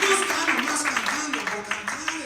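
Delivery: loud, high preaching; preaching at full force. Real, not acted. Preacher proclaiming testimony to the congregation, loudly.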